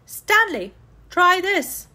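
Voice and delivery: loud high call upward. A narrator's reading. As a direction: call up through the ceiling. Calling up through crack.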